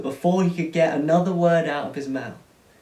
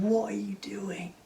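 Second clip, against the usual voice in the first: angry whisper; 6 semitones higher, 4 dB quieter than usual. Acted. Furious older son.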